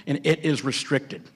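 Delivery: ordinary talk, nothing marked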